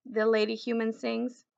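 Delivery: ordinary delivery, nothing marked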